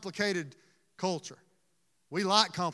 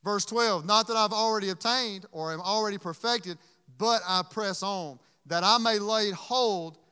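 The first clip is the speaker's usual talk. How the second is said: preaching scripture; 7 dB louder than usual. Acted. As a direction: proclaim the verse to the church. Reading scripture at full force.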